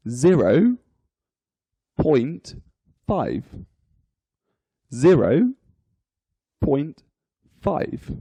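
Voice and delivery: each word set apart by pauses; digit by digit. Shown as a demonstration. Teacher reading a decimal for learners, digit by digit. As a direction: read the decimal out one word at a time. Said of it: "each digit is read individually".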